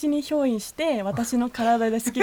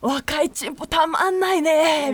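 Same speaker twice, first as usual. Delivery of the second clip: put-on creepy old man voice; 5 semitones higher than usual. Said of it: "めっちゃ憑依してるやん".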